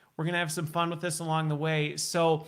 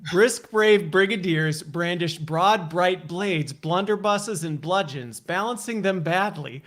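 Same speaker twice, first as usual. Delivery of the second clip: slow, slightly louder, crisp consonants; slow tongue twister; 4 dB louder, slower than usual. Shown as a demonstration. Host slowly demonstrating a longer tongue twister. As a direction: enunciate every B. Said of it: "A little longer".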